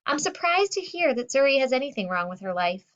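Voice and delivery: plain reading voice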